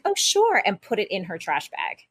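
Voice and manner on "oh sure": high mock-sweet "Oh, sure"; sarcastically sweet, pitched high; fake-cheery spite; sarcastic. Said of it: "despite her because I was just so annoyed".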